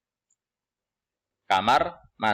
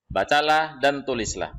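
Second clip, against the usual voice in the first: ordinary delivery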